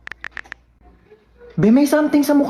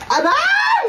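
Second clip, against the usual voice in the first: high yelp, aray; sharp ouch; mock yelp of pain. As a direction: cry out like hit. Hurt cry.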